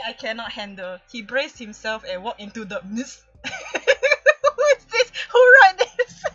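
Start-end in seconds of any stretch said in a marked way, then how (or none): 3.4-6.4 laughing hard, words breaking up; can barely get it out for laughing; helpless laughter, high and loud. Real, not acted. Cracking up reading script.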